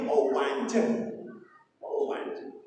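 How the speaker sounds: soft breathy mock of mother's endless prayer; drawn-out, half-whispered sigh of a son hearing the long prayer; imitating mother's long prayer. Son mimicking his mother's long prayer, whispered and slow. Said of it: "itu doanya ibu tuh".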